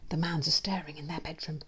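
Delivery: plain reading voice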